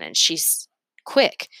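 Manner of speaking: usual speaking voice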